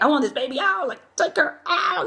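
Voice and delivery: desperate demand. Retold or imitated. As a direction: mock-desperate plea, climbing high. Desperate mother in labor, acted out.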